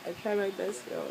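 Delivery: ordinary talk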